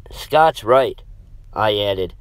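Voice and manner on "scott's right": plain reading voice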